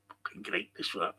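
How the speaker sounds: ordinary talk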